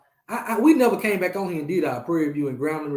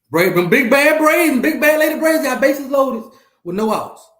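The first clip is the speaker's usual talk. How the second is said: excited play-by-play; 9 semitones higher, 10 dB louder than usual. Real, not acted. Streamer calling the Lady Braves game for viewers, loud and excited. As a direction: announce the big moment.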